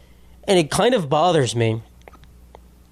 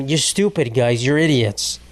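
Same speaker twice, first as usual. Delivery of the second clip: normal talking voice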